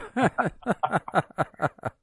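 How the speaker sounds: high rolling laugh; amused cackling chuckle. Real, not acted. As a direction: break into a long, high laugh. Amused by his own remark.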